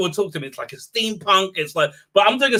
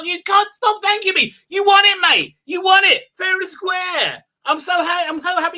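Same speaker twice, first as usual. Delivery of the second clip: excited shout; 11 semitones higher, 3 dB louder than usual. Real, not acted. Congratulating a chat contest winner.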